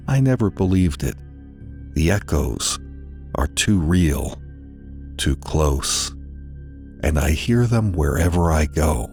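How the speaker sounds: ordinary delivery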